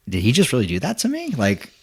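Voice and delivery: stunned, incredulous replay; thrown off. Retold or imitated. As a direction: retell it baffled, squeaking up on the last word. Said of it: "I was so thrown off".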